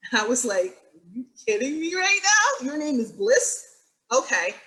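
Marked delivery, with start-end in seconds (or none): 0.9-3.6 amazed disbelief. Retold or imitated. Reacting to student's name Bliss.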